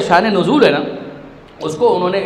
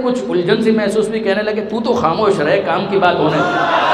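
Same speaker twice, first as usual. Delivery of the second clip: ordinary delivery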